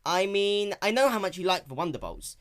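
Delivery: a hint of a young dragon, fumbling; nervous Spike. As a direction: cover it up innocently. Spike voiced.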